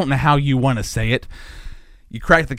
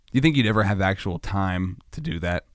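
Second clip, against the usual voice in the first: normal talking voice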